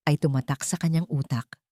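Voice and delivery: ordinary talk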